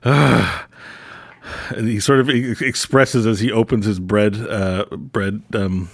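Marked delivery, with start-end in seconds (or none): 0.0-0.7 put-on exasperated old wizard. [name] exasperated.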